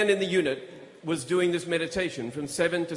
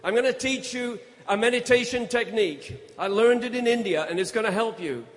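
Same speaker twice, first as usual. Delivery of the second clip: raised, higher call across the cells; 6 semitones higher than usual. Retold or imitated. Prisoner calling a meditation offer to his cellmate, raised call. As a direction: project it through the wall to a neighbour. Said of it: "I called out and said".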